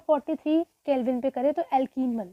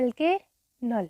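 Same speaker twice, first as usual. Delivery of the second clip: slowed; 2 semitones lower, 3 dB quieter, much slower than usual. Shown as a demonstration. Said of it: "क्या बोलते हैं".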